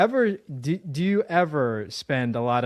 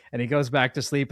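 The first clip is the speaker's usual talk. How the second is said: normal talking voice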